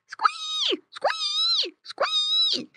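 very high piglet squeal; repeated high-pitched squee. A narrator's reading. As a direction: squeal like a little pig in trouble. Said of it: "yelled Squinty".

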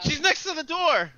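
high, loud outburst; exclaiming. Real, not acted. Exclaims at player's position by door.

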